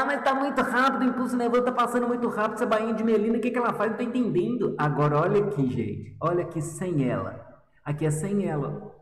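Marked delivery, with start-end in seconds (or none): 0.0-4.7 higher, fast, puzzled student voice; confused student. Retold or imitated. Teacher voicing a confused student asking her, higher and fast. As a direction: play the confused student rushing the question. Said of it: "Aí você fala".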